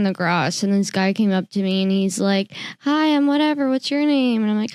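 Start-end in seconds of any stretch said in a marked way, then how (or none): 2.8-4.4 imitating cheerful stranger. Stranger's greeting retold.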